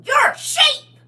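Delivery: high, loud, squeaky put-on voice; high squeaky sheep. Scene: quoting the sheep scene in Babe, high and squeaky.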